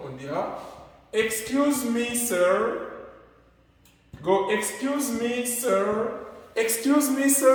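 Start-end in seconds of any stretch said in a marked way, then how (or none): 1.1-7.5 high, drawn-out excuse me sir; polite attention call. Shown as a demonstration. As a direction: call out to get a stranger's notice. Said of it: "comment attirer l'attention d'une personne".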